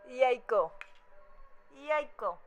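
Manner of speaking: "Jajko" said as Polish, YAI-ko; "Jajko" as two crisp Polish syllables, YAI-ko; Polish pronunciation. Shown as a demonstration. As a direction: demonstrate the Polish "Jajko" with a y sound at the start. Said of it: "The Polish word for egg".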